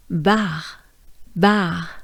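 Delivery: "Bar" with French A and throat R; French pronunciation. Shown as a demonstration. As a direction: say "bar" as a French word, separately. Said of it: "pronounce it with a French accent".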